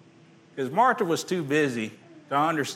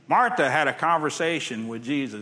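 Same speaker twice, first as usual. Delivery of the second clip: usual speaking voice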